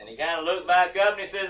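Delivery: normal talking voice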